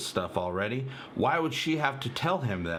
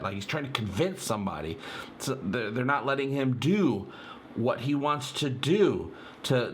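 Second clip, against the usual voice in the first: usual speaking voice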